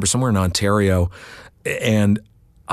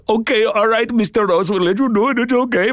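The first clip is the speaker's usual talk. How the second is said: put-on light Indian accent, pitched much higher, reluctantly giving in; imitating the Indian car salesmen, higher and hesitant; 12 semitones higher than usual. Salesmen letting the narrator use the back room, in a mock Indian accent. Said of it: "they were all Indian".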